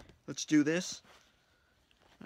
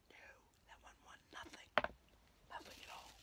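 whisper; 16 dB quieter, faster than usual. Real, not acted. Whispering numbers while scratching.

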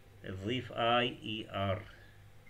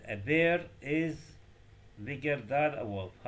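slow reading; 2 semitones higher, much slower than usual. Shown as a demonstration. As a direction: read out the sentence you want them to notice. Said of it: "لاحظ هذه الجملة". (قراءة بطيئة ومشددة)

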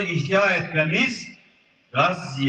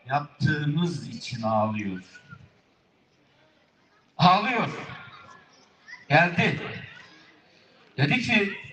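usual speaking voice

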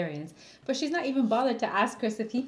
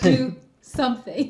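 mock order; 8 dB louder than usual. Mock command.

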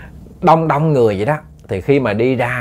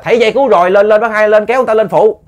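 high, loud call to climb up; urgent shouted coaxing; 10 semitones higher, 6 dB louder, faster than usual. Retold or imitated. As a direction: urge him aboard with raised voice. Boat owner shouts to coax swimmer aboard. (gọi lớn hối thúc, giọng cao)

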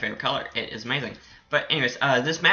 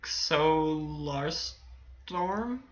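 very slow, softer, drawn-out name; unsure sounding out; 7 dB quieter, much slower than usual. Real, not acted. Gamer attempting an unfamiliar creator's name, unsure sounding out. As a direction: stretch each syllable, feeling out the name. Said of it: "I can't pronounce your name".

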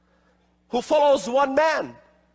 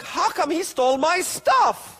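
retold indignation; 7 semitones higher than usual. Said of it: "I was just like".

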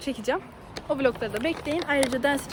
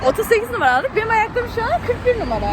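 louder, higher echo of mom; loud mocking echo; 7 semitones higher, 4 dB louder than usual. Real, not acted. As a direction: repeat her words back, louder and higher.

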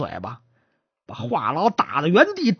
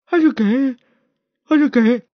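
acted stammer, higher pitched; stutterer; 6 semitones higher than usual. Stutterer trying to answer the magistrate, stuck and repeating. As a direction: get stuck on the same opening words. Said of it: "这结巴跪那墙角了".

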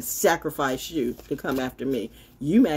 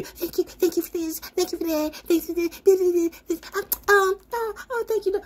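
high squeaky mock gratitude, far above her own; mocking thank-you voice. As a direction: sneer out a breathless fake thank you. Mocking grateful streamers.

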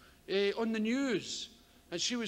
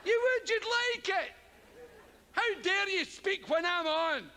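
mock indignant star; 9 semitones higher than usual. Comedian scolding a heckler, hammed-up pompous outrage.

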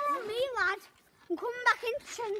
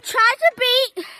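loud challenge; 3 semitones higher, 12 dB louder, slower than usual. Real, not acted. Challenging viewers loudly.

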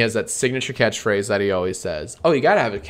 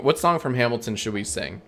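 ordinary talk

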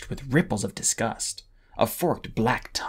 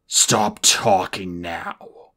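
very slow, low menacing order; cold command; 4 semitones lower, 3 dB louder, much slower than usual. A narrator's reading. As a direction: let the silence fall with each word. Moroseer silencing the dragonettes.

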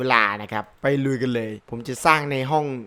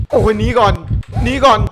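high, loud panicky call; urgent shout; 8 semitones higher, 9 dB louder than usual. Real, not acted. Gamer fleeing the titans, urgent high shout. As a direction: shout it high while making an escape.

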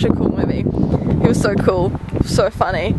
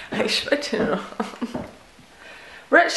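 ordinary delivery

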